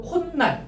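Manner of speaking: 콧날 as kon-nal, double n; demonstrated 콧날. Shown as a demonstration. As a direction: hold the linked n in 콧날. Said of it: "이렇게 발음됩니다". ("콘날"로 니은을 살려서)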